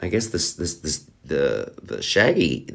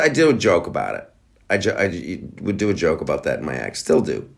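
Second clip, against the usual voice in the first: usual speaking voice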